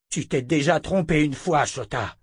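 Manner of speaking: a touch of an angry shout; angry cry. A narrator's reading. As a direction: cry out in reproach, dropping on the name. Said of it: "cria le sourcier".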